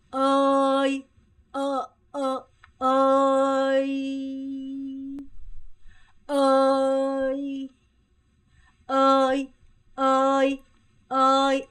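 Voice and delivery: 어이 called out loud, long and level; drilled call. Shown as a demonstration. Teacher drills students on 어이, long loud call. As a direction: shout 어이 as a stretched level call. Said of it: "무조건 그냥 따라하세요".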